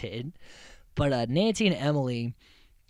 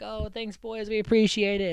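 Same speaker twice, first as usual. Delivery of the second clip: higher, breezy fake gratitude; high mock thank-you; 7 semitones higher than usual. Nancy and Emily thanking their boys, high and sweet. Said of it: "this funny little moment where they're like".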